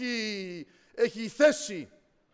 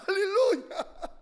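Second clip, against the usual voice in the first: alleluia through a happy laugh; joyful laughing praise; 13 semitones higher, 8 dB louder, slower than usual. Real, not acted. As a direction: praise God while laughing. Laughing praise after the story.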